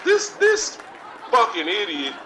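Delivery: ordinary delivery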